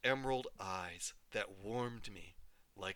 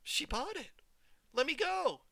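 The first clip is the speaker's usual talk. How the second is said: much higher, pleading in pain; pained protest. Acted. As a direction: cry out while your fingers are twisted. Twisted fingers, crying out.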